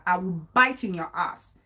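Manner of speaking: normal talking voice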